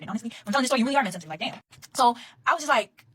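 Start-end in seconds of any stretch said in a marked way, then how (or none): 1.9-3.0 slow, high and loud, setting up the punchline; dramatic build-up. Retold or imitated. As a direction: draw it out, higher, with emphasis. Dramatic pause before reaction.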